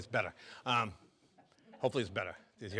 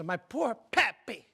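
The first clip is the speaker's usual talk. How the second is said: put-on high-pitched Mexican-accented lament; hammed-up Mexican widow; 9 semitones higher than usual. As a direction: wail like a grieving Mexican wife, climbing higher. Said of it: "The Mexican wife says".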